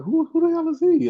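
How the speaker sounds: shrill baffled who; baffled high squeak. Retold or imitated. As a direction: squeak it out, confused. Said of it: "I'd be like".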